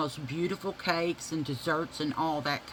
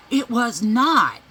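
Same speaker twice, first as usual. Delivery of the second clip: louder, slow, stubborn "It was not"; indignant denial; 5 semitones higher, 9 dB louder, slower than usual. Retold or imitated. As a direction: deny it flatly and loudly. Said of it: "She goes".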